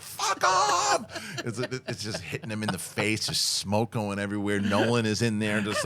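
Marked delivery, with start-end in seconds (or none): 0.0-1.4 very high, drawn-out screamed curse; mock scream. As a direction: imitate a frantic projectionist screaming back. Acting panicked projectionist snapping back.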